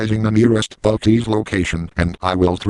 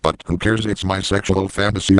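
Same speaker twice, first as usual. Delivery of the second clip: ordinary talk, nothing marked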